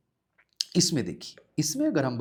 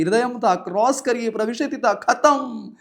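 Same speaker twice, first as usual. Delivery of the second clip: building excitement; 6 semitones higher, 3 dB louder than usual. Real, not acted. Crossing words, building up.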